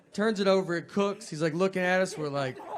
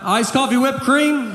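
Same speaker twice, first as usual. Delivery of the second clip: high, loud call of the order; barista yelling out; 8 semitones higher, 5 dB louder than usual. Retold or imitated. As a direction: shout the order across the counter. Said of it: "She just yells out".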